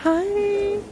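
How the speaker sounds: high little coo; cooed dog greeting. Real, not acted. Saying hi to the friendly dog, cooing.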